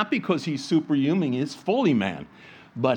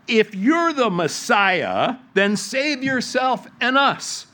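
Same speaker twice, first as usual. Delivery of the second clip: higher, slow sneering taunt; a criminal jeering, drawn out and raised; blasphemous mocking; 7 semitones higher, 3 dB louder, slower than usual. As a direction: taunt him from the next cross. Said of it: "blasphemed him, saying".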